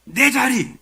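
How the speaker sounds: high, loud indignant outburst; mock furious cry; acted anger. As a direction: burst out as an angry driver claiming what's yours. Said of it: "막 화를 냅니다".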